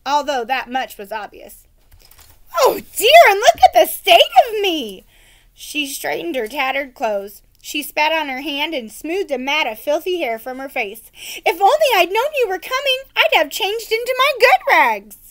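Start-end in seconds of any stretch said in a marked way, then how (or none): 2.6-5.1 flustered peasant woman. Acted. 11.5-15.2 flustered peasant woman. Acted.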